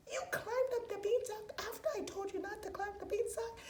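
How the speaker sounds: high, disbelieving mom; high-pitched outrage at her son; shocked mom. Acted.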